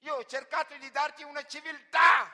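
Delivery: master roaring in outrage, very high and loud; enraged owner's scream; furious shout. Acted. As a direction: blast it at the slave who ran away. Enraged master scolding runaway slave.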